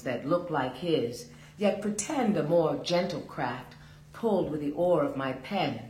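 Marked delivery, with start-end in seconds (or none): none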